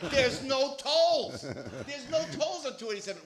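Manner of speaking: high, drawn-out exasperation; exasperated rant. Acted. Comedian complains about toll-free highway traffic, exasperated high wail. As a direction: stretch it out in high-pitched disbelief.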